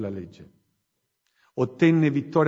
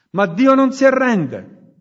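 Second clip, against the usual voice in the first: higher, much louder proclamation on "Dio non si arrende"; ringing declaration; 8 semitones higher, 8 dB louder than usual. Acted. Preacher assuring the congregation God never gives up, ringing proclamation. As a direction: cry it out as a triumphant promise. Climax of sermon.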